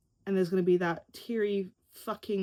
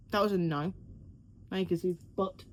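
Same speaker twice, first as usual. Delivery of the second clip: ordinary delivery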